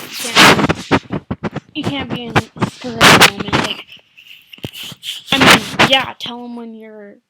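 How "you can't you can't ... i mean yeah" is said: loud. Real, not acted.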